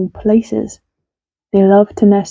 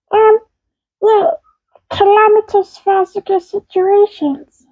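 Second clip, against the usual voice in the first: high puppet voice; 11 semitones higher than usual. Acted. Puppet asks about words.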